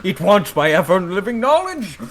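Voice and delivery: high, loud frantic yelling; panicked shout. Acted. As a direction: scream it out in panic. Fish fleeing a sea dragon.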